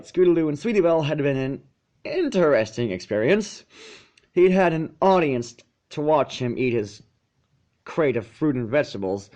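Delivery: plain reading voice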